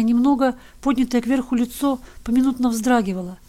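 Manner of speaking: plain reading voice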